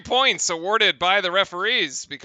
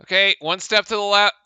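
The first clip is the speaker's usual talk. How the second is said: ordinary delivery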